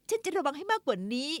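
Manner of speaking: ordinary delivery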